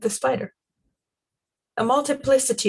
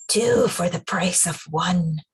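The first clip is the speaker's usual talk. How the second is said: hissed spider threat; 2 semitones lower, slower than usual. Acted. Spider creature hisses at her two trapped prey. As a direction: savour each word like a predator. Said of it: "hissed the Spider-Woman".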